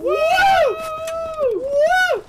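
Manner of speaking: loud high whoop; excited whoop. Real, not acted. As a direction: cheer like a rodeo rider. Cheering on horseback.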